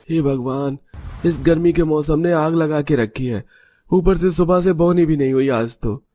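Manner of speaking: a touch of heat-weary complaint; lightly voiced weary vendor. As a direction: grumble lightly as a hard-up street seller.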